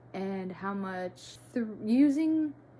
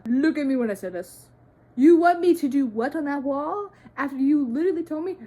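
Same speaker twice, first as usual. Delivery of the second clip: loud, high mock outrage; incredulous protest; 6 semitones higher, 12 dB louder than usual. Daughter to parents asking for a mural, incredulous mock outrage. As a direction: confront them, much louder and higher in disbelief.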